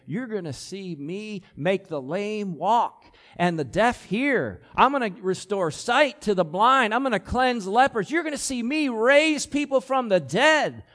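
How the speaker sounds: imagined Jesus, climbing to a big finish; rising excitement. Retold or imitated. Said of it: "now Jesus is gonna say".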